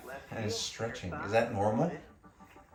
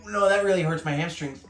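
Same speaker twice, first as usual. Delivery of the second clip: ordinary delivery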